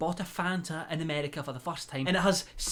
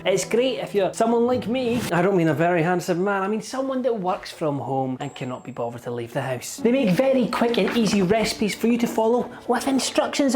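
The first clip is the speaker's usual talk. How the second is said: loud upbeat sponsor pitch; punchy, louder promo voice; ad read; 9 dB louder than usual. Acted. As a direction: sell it like an energetic ad read.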